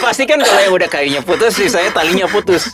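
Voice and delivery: laughing. Real, not acted.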